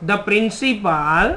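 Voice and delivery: very slow, word by word dictation. Shown as a demonstration. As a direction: spell the sentence out slowly for the class. Teacher dictates the start of a sentence. (ધીમે ધીમે લખાવવું)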